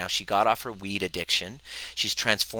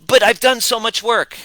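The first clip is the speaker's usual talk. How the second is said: high, loud mock-whine of a frustrated client; mock client whining louder and higher; whiny client; 13 semitones higher, 10 dB louder than usual. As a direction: imitate a client protesting, pitched way up and pushy. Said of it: "And then they go".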